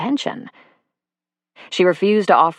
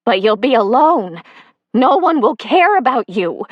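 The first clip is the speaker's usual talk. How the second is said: higher and louder, desperate; cling to him with questions; wife begging him to stay; pleading Sonia; 5 semitones higher, 5 dB louder than usual. A narrator's reading. Sonia's last plea.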